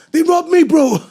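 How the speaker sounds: shouting in fake panic, high and loud; fake panicked shout. To the waiting buyer, fake panicked yelling. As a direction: play the robbed victim, yelling.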